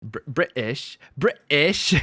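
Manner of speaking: "British" with the T dropped to a catch in the throat; mock British glottal stop in "British"; British without T's. Shown as a demonstration. Streamer teasing Brits about dropped T's, mock British pronunciation of "British". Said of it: "pronounce things without T's".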